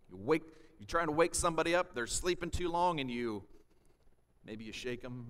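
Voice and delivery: ordinary talk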